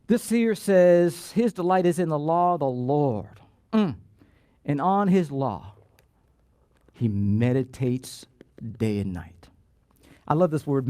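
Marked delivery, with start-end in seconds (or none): 1.3-9.5 measured reading that fades at the end; scripture reading. Real, not acted. Rereading the verse slowly.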